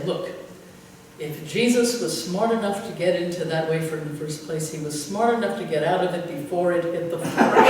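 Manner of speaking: ordinary talk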